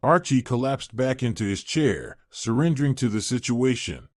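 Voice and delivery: ordinary delivery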